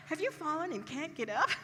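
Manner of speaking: lightly mimicked famous TV ad cry for help; old ad line. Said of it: "That is a joke in our family".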